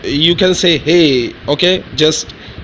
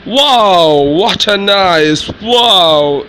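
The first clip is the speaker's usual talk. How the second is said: excited wow; 4 semitones higher, much slower than usual. Shown as a demonstration. Teacher showing English use, an excited wow. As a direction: gush it like you're amazed. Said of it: "You can say in English".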